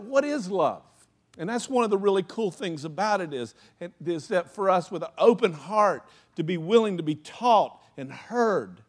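usual speaking voice

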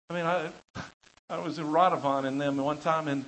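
normal talking voice

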